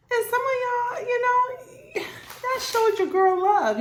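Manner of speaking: giggly and touched; laughing thanks to her viewers. Real, not acted.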